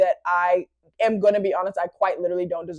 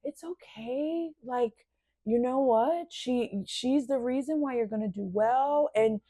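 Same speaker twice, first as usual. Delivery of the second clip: much softer, slow at the start, half-asleep comfort; soft sleepy voice; 3 semitones higher, 8 dB quieter than usual. Retold or imitated. Sleepy boyfriend comforting his crying girlfriend, soft and drowsy. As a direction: murmur it like he's still asleep.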